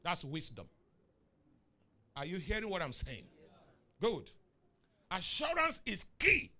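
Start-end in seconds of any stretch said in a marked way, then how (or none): 5.1-6.5 much higher and louder, slow; emphatic preaching. Real, not acted. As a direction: hammer home the key word. Preacher stresses key point.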